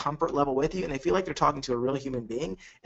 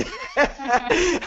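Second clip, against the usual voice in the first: high laugh; 11 semitones higher than usual. Real, not acted. Laughs at own joke.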